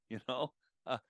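normal talking voice

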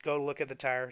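ordinary talk, nothing marked

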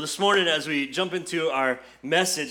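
usual speaking voice

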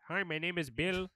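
mock call center rep voice; call center agent. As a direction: chirp it like a phone rep. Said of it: "when you call a call center and it's like".

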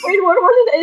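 ordinary delivery

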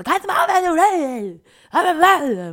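high, very loud mock shriek; imitated freak-out. Friend who can't stand whispering, imitated furious outburst. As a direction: explode in exasperation, parodying an irritated friend. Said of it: "die ist ausgerastet".

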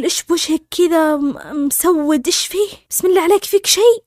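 imitated high-pitched, alarmed questioning; shocked alarm. Younger sister sees her sister's face, imitated shock. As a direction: raise the pitch sharply, building into worried urgency. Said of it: "أختي الصغيرة شهقت".

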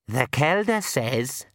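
put-on slow, cracked old pixie voice. A narrator's reading. Old pixie relays the Kelda's word, slow cracked voice. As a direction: draw it out like a wizened old pixie. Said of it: "said a cracked voice by his ear".

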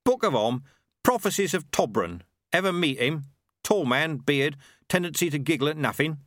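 a proud tradesman talking shop; Ronnie the milkman. Acted. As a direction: Ronnie the milkman, pleased with his product. Ronnie describing a prophet.

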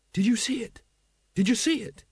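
put-on high, eager young watchman; breathless rush of big news, pitched high; excited. Carrot turns up bursting to report the dragon, excited.

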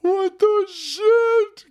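high drawn-out shock; shocked squeal. Real, not acted. Streamer stunned by a movie clip, high slow shock. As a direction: stretch it out high in disbelief.

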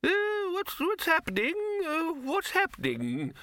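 put-on very high, quavering old codger; fretful, dithering old man up in a high register; bewildered old wizard. Deaf old wizard asks the others, bewildered and doddery.

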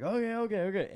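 high, loud, hurried-sounding acquiescence; mock-panicked kid giving in; flustered compliance. Caught climber answering the screaming teacher, flustered.

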